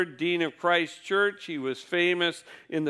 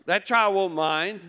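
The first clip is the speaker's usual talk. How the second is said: normal talking voice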